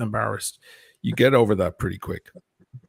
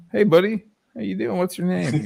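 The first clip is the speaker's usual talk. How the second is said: friendly high greeting; 9 semitones higher than usual. Pretend. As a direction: greet a kid warmly. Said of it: "kinda go".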